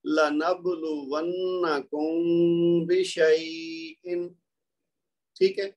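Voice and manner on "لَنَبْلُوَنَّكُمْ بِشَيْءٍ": slow recitation, the م of لَنَبْلُوَنَّكُمْ hummed through the nose; recited with ghunna. Shown as a demonstration. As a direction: hide the م of لَنَبْلُوَنَّكُمْ before بِشَيْءٍ. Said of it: "تو کیا ہوا؟".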